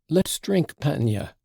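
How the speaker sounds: high, sudden blurt. A narrator's reading. Mitya failing at a speech, blurting. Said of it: "he blurted out".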